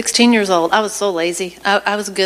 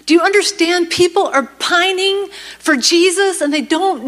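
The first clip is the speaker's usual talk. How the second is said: fiery preaching; 7 semitones higher than usual. Acted. Preacher urging the church audience to act, impassioned. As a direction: plead with the crowd to wake up.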